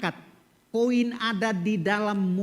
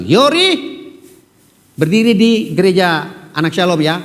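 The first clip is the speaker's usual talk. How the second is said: calling out; 7 dB louder than usual. Acted. Pastor calling his son forward.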